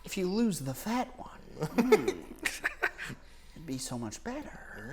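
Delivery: slow, menacing with a laugh; evil villain voice. Pretend. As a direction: purr it like a cartoon villain. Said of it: "you sound like a, like a bad guy".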